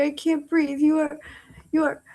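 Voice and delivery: usual speaking voice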